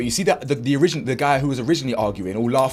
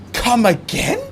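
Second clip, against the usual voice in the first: high, incredulous come again; 8 semitones higher, much slower than usual. Real, not acted. Vlogger repeating himself at the clip, high incredulous rise. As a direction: pitch it way up, rising in disbelief.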